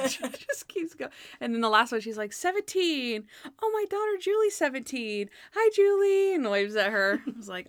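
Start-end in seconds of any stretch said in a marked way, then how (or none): 2.3-6.3 high, singsong awkward presenter; gushing mock TV greeting; [name]. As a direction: overact a proud mom on air. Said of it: "Bad [name] acting is so funny".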